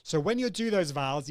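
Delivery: ordinary delivery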